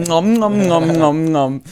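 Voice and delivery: mock loud munching, nom nom; hammed-up chewing sounds; loud chewing noises. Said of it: "Do it, do it louder".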